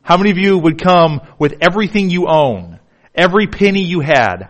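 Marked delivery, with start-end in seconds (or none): none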